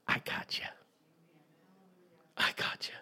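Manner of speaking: hushed, reassuring whisper; gentle whisper. Retold or imitated. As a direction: whisper it close and sure. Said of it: "And the Lord said".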